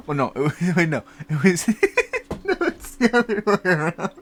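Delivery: talking through real giggles, high and loud; laughing. Real, not acted. Host muddling spaghetti pie description to listeners, laughing helplessly. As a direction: crack up while trying to correct yourself.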